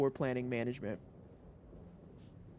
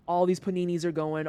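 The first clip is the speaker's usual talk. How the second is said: normal talking voice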